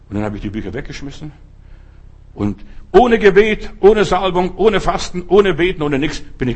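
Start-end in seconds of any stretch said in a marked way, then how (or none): 2.9-6.2 preaching shout. Real, not acted. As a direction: build it like a pulpit cry. Preaching climax of healing testimony.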